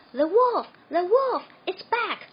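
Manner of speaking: high, very loud alarm; yelling. A narrator's reading. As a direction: scream the warning. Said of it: "he yelled".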